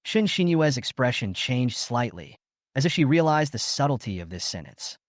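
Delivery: usual speaking voice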